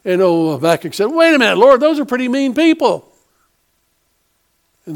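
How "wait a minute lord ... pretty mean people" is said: higher and louder, a retold gasp of alarm; startled protest. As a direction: play Habakkuk balking at God's plan, higher and louder. Habakkuk protests to the Lord.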